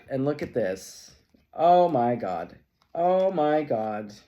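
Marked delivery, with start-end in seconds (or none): 1.5-4.1 loud, slow oh my God; amazed. Real, not acted. Reader marvels at the cards, loud and slow. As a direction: stretch it out in loud awe.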